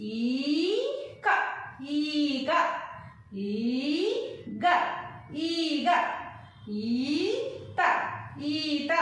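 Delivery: very long ఈ opening ఈగ, ఈత, ఈల; long vowels. Shown as a demonstration. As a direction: draw out the ఈ in ఈక, sliding up, first split then joined. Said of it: "దీర్ఘం తీసి పలుకుతాం".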